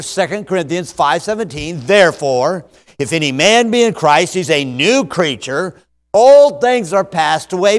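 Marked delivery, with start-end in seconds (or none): 1.9-7.8 loud, ringing recitation building to a big Behold; recited memory verse. Shown as a demonstration. Preacher reciting the memory verse loudly to his congregation. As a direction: proclaim the verse from memory with rising force. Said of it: "Add just before and after".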